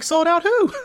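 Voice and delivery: high, squeaky rising disbelief; shocked mock question; disbelieving question. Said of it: "I'm like".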